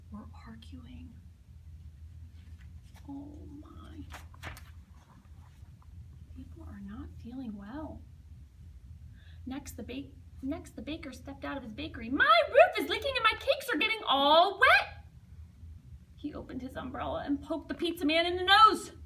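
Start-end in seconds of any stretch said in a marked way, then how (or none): 0.1-4.0 soft whispered narration; storyteller hushing over the quarrel; hushed. A narrator's reading. 12.1-15.1 high, loud, upset baker; loud complaint. Acted. Baker complaining about leaking roof.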